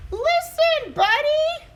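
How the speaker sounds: high, loud yell of the name. Acted. Parents yelling to get Buddy's attention, shouting. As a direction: shout it across the yard. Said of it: "His parents tried yelling".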